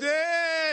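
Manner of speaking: drawn-out whiny wail; imitated child crying. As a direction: wail like one kid starting off. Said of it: "a chorus of children just crying".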